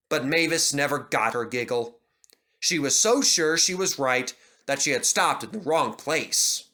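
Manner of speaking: usual speaking voice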